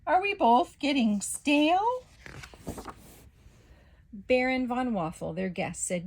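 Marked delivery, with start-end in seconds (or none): 0.1-2.0 high, loud panicked scream. Acted. Pancake screaming at Toast about going stale. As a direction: shriek it in alarm. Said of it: "Pancake then screamed".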